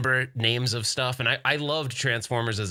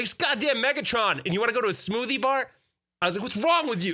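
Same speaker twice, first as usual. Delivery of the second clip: high, exasperated rant; incredulous rant; 11 semitones higher than usual. Retold or imitated. Dad ranting at his kids about Megatron, high and exasperated. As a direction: berate them in disbelief.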